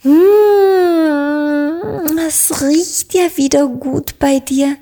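hohes, lautes, genießerisches Hm; laut gerufen. A narrator's reading. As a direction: ruf begeistert und laut als kleine Hexe. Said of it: "rief die kleine Hexe Dorothee". (kleine Hexe ruft laut und hoch vom Kuchenduft)

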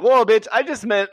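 laughing mock outburst at a rude guest; exasperated laughing retort. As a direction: blurt it out laughing at the nerve of her. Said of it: "and I was like".

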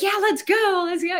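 high, eager and loud; excited cheer. Retold or imitated. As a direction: cheer it like a thrilled kid. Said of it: "he was like".

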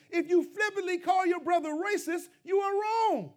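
loud, high preacher's cry; full-force pulpit shout; preaching shout. Real, not acted. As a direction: hammer the warning home to the congregation. Preacher's climactic warning.

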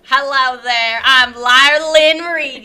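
a put-on Southern drawl, slow and loud; hammed-up Southern belle intro, stretched out; bad Southern accent. Introducing herself on the podcast in a bad Southern accent. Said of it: "that's, like, the worst accent I've ever done".

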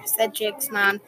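usual speaking voice

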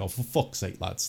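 low, quiet fed-up mutter of "for fuck's sake"; muttered exasperation. Retold or imitated. Podcaster retelling his reaction to the signings, quietly exasperated. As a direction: grumble it under your breath. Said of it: "the tipping point where it was just like".

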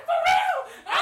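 high laughing shout of joy; excited shout. Retold or imitated. Hearing the acceptance news, shrieking with joy. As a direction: scream it with delight. Hears she got in.

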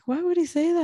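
high-pitched incredulous question; retold astonishment. Relatives asking about the nephew's remark, retold as astonished. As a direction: imitate baffled listeners, pitched well up.

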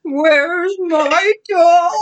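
high, loud drawn-out wail; groaning creature voice. A narrator's reading. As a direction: wail it slowly like a ghost at the door. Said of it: "the voice groaned".

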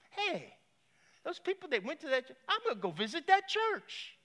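high, bright burst of a new idea; light up as the idea hits; sudden idea. Retold or imitated. Man with cancer decides on Sunday morning to visit the church, excited and high.

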